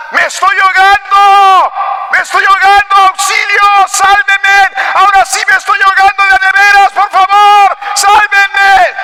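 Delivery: high, sustained drowning cries; acted desperate shouting. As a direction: yell for rescue as the boy truly drowning. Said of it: "comienza a gritar". (gritos de auxilio desesperados, actuados)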